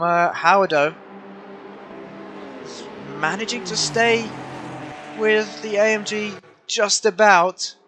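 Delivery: lively live race play-by-play; motorsport commentator. Real, not acted. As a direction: call the race as it happens.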